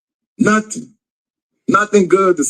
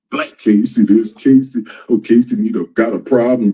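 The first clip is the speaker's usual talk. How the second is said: low, mocking quote of others' talk; mocking imitation; 6 semitones lower than usual. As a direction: drop low to mimic their nagging. Mocking what others keep saying.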